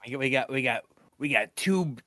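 loud, slow, drawn-out pirate. Pretend. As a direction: stretch out the doubloons like a pirate. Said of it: "you gotta say it like a pirate".

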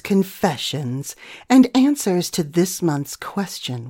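ordinary delivery

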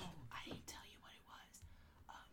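soft unvoiced whisper. Real, not acted. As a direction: whisper it as if monsters might hear. Whispering the situation report.

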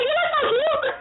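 high gushing compliment; gushing boss. Retold or imitated. CEO complimenting her outfit, high gushing coo. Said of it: "she was like".

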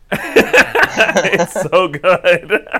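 high, loud words through laughter; helpless real laughter while talking; laughing. Real, not acted. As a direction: gush it while cracking up. Laughing at her favorite line.

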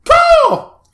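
loud, high-pitched squawk; sudden high bird cry; mock seagull caw. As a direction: screech it out like a seagull. Imitates seagull after McChicken.

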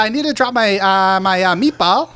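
high, loud, hammed-up accent; badly faked Swedish sliding into New Jersey soccer mom; failed Swedish accent. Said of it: "He went from New Jersey soccer mom".

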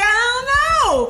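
very high, loud, drawn-out whine; mock wailing. Rattled hopeful pleads with the executive, loud mock wail. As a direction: wail it in hammed-up helplessness. Retold helpless reply to the executive.

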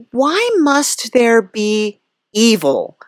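normal talking voice

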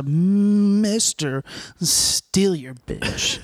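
very slow, drawn-out nickname reveal; mock-grand announcement. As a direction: stretch each word out like a hype announcer. Grandly announces his boastful new nickname.

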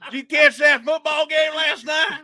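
high, bright, chatty sportscast banter; mock morning news anchor. Friend imagining Emily anchoring the morning news, high perky chatter. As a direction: play a chirpy morning show host. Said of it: "there's Emily".

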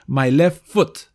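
"My left foot" read slowly with a short u in foot; slow teacher read; slow model phrase. Shown as a demonstration. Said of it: "Number three".

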